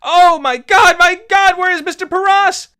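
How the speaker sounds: a frightened woman screaming for her master; terrified cries. Acted. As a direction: cry out in panic for help. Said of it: "and the cries of".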